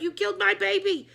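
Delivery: high, loud grieving wail; anguished accusation. A narrator's reading. Author wailing at the principal, high and loud. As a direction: accuse in grief.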